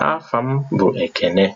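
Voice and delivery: ordinary talk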